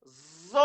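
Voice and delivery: "Zo" with a long buzzed Z into O; emphatic zo sound. Shown as a demonstration. Teacher reading the exception letter aloud, drawn-out "Zo". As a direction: stretch "Zo", vowel rounded to O. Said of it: "on va lire".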